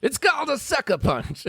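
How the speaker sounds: high, showy trainer voice giving silly advice; pompous swami trainer. Acted. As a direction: play a self-important turbaned coach. Trainer's voice in the memory.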